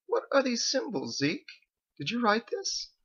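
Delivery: high, curious question; ask, intrigued and uneasy; puzzled wife. Acted. Wife finds strange symbols.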